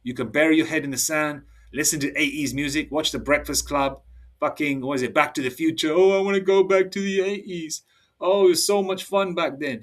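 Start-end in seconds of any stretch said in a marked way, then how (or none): 5.9-9.8 higher mock-wistful whine; put-on, higher nostalgic moan; mocking nostalgia. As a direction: imitate someone pining for the old days.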